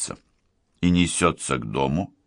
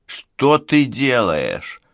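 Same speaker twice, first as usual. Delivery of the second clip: drawn-out, higher worried question; slow, raised-pitch alarm; uneasy; 6 semitones higher, much slower than usual. A narrator's reading. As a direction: ask it slowly, with rising unease. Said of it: "Сильверу неспокойно".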